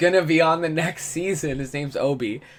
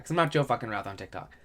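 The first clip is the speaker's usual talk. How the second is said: ordinary delivery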